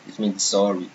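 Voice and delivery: ordinary talk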